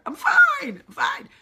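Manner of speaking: squeaky, very high, loud forced fine; Ross Geller. Retold or imitated. Imitating Ross Geller, a squeaky forced fine. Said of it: "I was like Ross Geller".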